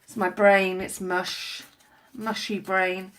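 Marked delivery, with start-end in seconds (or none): none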